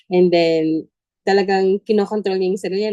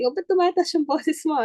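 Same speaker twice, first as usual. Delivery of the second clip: higher, mock-chiding question from a big sister; older sister scolding; 10 semitones higher than usual. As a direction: tease a little sibling about raising her voice. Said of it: "sabi nga ni ate". (ate na mataas ang boses na pumupuna)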